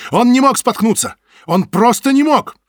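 high, loud desperate cry; shouting. Acted. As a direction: protest at the top of your voice. Butovich bursts in protesting.

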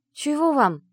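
panting after the climb; out of breath. Acted. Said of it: "немного отдышавшись".

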